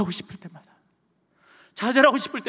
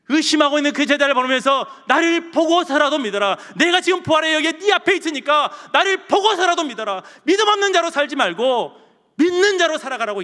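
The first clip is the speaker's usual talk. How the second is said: loud, high preaching voice; 예수님 말씀을 힘차게 선포; 11 semitones higher, 10 dB louder than usual. Acted. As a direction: proclaim it urgently as Jesus' appeal. Preaches Jesus' words. (높고 크게 외치는 설교)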